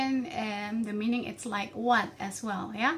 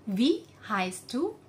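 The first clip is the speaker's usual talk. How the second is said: ordinary delivery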